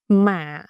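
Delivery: "mạ" said as mả, sinking in pitch; dipping tone. Shown as a demonstration. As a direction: let "mạ" dip like a hỏi tone. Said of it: "It's a dipping tone".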